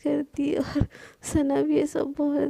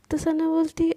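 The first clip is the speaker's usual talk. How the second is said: ordinary delivery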